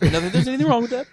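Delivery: high, loud, quick mock disclaimer; Seinfeld catchphrase. Friend quoting a sitcom after being reminded of it, high quick mock protest. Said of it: "Sounds like the Seinfeld episode".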